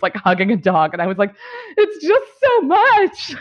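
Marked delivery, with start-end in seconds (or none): 1.8-3.4 high, drawn-out tearful wail; mock crying. Telling how they hugged a dog in the street, mock crying. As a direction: wail it slowly, imitating an overwhelmed sob. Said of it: "crying in the streets".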